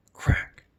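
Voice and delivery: a sharp whispered crack, no voice. Acted. Narrator whispering a twig crack in the dark. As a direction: hiss the snap of a branch like a secret. Sound effect in spooky night.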